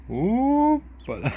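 high, loud, long drawn-out hoppala; playful hoppala. Pretend. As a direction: stretch it out like lifting a baby. Lifting the baby animal up playfully.

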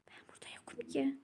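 hushed, quick whisper so nobody hears. Pretend. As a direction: whisper it, hiding from your friend. Hiding, pretending not to be there. (saklanırken fısıltıyla)

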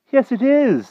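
put-on high, loud boy's voice; eager boy. A narrator's reading. Young Wart greets the king, eager. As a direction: pipe up eagerly, pitched well up.

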